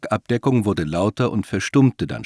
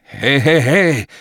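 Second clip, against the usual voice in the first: loud, higher-pitched protest; shocked shout; 6 semitones higher, 7 dB louder, slower than usual. A narrator's reading. Man reacting to an order to kill, shocked. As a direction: call out in shock to stop him. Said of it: "rief James schockiert".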